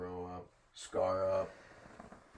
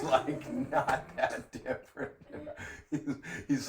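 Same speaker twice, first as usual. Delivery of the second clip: ordinary delivery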